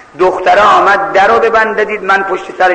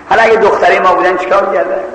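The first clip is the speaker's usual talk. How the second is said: ordinary delivery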